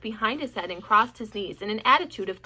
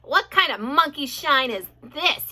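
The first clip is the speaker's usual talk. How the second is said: growled; 4 semitones higher, 8 dB louder than usual. A narrator's reading. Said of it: "he growled".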